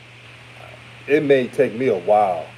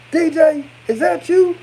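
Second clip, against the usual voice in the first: high-pitched, louder imitation of a fan calling out; excited fan; 5 dB louder than usual. Fan spotting the host out in town, excited. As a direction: mimic a fan's surprised call, pitched way up. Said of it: "Someone said my name".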